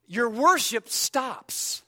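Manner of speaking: very slow, weighty drawn-out words; slow emphasis. Real, not acted. Preacher driving home the point to the congregation, very slow emphasis. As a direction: lean hard on every word.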